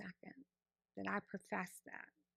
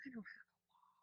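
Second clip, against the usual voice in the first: 1 whispered thought; 3 semitones higher, 14 dB quieter than usual. Retold or imitated. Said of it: "And I'm thinking".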